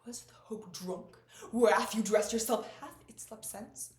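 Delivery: theatrical recitation building from slow to very loud; grand stage delivery swelling to full volume; Lady Macbeth. Acted. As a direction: declaim it slowly, surging into a forceful challenge. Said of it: "my dream to play Lady Macbeth".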